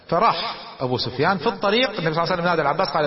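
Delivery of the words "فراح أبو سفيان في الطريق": normal talking voice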